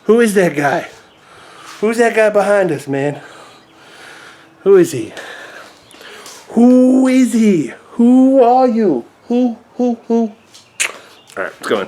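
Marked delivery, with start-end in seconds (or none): 0.0-5.1 playful wonder. Real, not acted. Pointing baby to own image. 6.5-10.3 high, loud, very drawn-out words; slow singsong hoots; playful hooting. Real, not acted. Playful game with baby.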